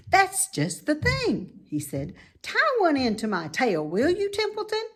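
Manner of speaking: high, loud piggy voice; a young pig, eager and impressed; bright squeaky pig voice; Wilbur the pig. A narrator's reading. Wilbur pleased.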